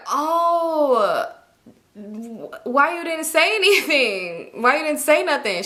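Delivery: high, slow, half-laughing disbelief; shocked disbelief. Retold or imitated. Friend reacts to hidden spotting with laughing disbelief. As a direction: gasp out the question with a laugh.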